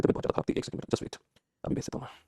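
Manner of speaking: breathy whisper away from the mic; whispered aside. Real, not acted. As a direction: murmur a quick side note under the breath. (फुसफुसाकर धीमी बात)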